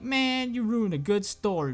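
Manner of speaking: high put-on grumble; Torbjorn. Torbjorn to Reaper, high mock disappointment. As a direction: pitch it up in mock complaint. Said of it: "Torbjorn's like".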